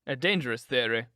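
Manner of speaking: ordinary delivery, nothing marked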